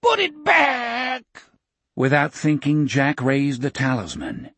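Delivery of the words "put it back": high, slow, droning bug voice; whining insect voice. A narrator's reading. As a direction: whine it like a giant spider. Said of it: "in its whining, droning insect's voice".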